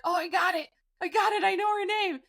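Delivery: high, excited realization; retold eureka. Sleeper thinking she has the name, excited high voice. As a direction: act out suddenly remembering.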